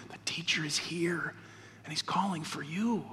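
soft, near-whispered confidence; private hushed message. A narrator's reading. Martha privately calling her sister, hushed. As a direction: pass it on quietly, for her ears only. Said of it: "told her privately".

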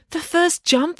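high chirpy instruction; bossy girl voice. Acted. Loretta points.

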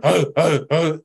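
sharp, repeated gasps, acted out; imitated gasping for air. Recalling detox withdrawal on his bed, imitated gasping. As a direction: act out gasping for breath. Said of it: "gasping for air on my bed".

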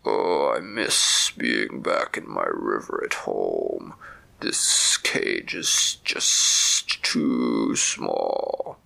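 very low, very slow, mournful snake voice; deep-voiced big snake. Big caged snake missing its river, in a very low slow voice. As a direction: sink the voice down low and drag each word. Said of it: "a very big snake with a deep voice".

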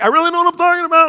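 high, loud, fast protest; exasperated Paul. Retold or imitated. Paul insisting to the sailors, high, loud and fast. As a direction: rattle it off as a frustrated Paul. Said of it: "Paul's like".

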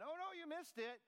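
high, hammed-up "gotcha" scold; lightly mocking tone of an accusing voice. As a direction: tease like a nagging finger-wagger. Mock God scolding a failure.

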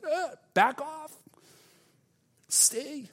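high, slow, squeaky mock plea; overwhelmed squeal. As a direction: imitate being torn, in a tiny high voice. Acts torn reaction to love.

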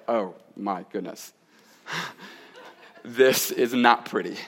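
soft, slow, laughing groan at the mirror; half-laughing, hushed and slowed, mock horror; mock mirror dismay. Pastor imitating himself at the morning mirror, soft laughing dismay. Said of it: "you're like".

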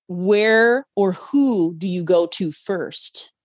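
very slow, drawn-out question; host posing a puzzle, slowly. Real, not acted. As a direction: lay it out carefully. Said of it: "asking game question deliberately".

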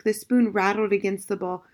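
usual speaking voice

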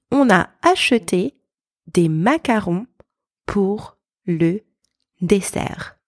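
French phrase by phrase, with pauses; careful French in short separated chunks; slow practice sentence. Shown as a demonstration. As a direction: model it clearly for learners to repeat. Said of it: "let's pronounce a few sentences".

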